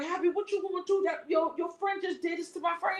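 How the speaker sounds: high-pitched, mildly indignant appeal; retold upset appeal. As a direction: re-enact confronting him over a friend. Retells confronting the friend's companion.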